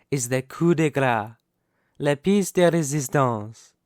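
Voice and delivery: ordinary delivery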